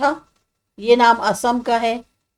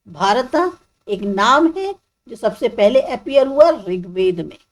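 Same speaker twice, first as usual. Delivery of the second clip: normal talking voice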